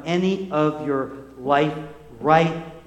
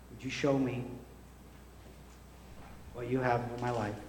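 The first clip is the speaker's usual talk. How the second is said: hushed prayer; 5 semitones lower, 8 dB quieter than usual. Acted. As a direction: pray quietly over the congregation. Leads a prayer.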